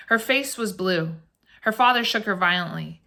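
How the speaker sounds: ordinary delivery, nothing marked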